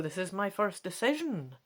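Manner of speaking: plain reading voice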